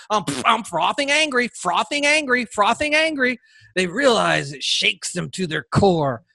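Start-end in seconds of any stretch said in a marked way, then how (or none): 0.1-3.4 hammed-up rage, pitch climbing with every repeat; mock frothing anger. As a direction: pretend leftist fury, climbing higher each time. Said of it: "If I'm a leftist now".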